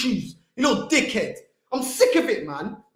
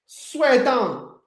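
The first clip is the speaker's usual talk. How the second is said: normal talking voice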